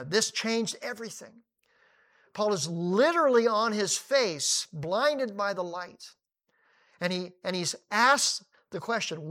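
normal talking voice

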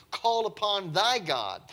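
lightly acted, drawn-out command; captain's mild urging. Captain urges Jonah to pray.

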